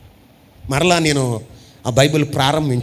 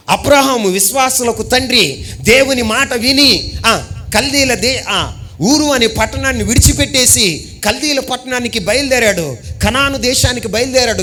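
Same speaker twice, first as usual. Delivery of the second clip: building sermon cadence, a bit higher; preaching; 5 semitones higher than usual. Acted. Preacher recounting Abraham to the church, fervent. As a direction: drive each line home like a preacher.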